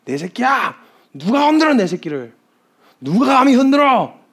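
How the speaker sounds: high, loud protective shout; threatening outrage. Acted. God calls to his child and challenges the attacker, outraged shouting. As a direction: burst out in acted parental fury. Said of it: "막 협박을 있는 대로 쏟아놓기".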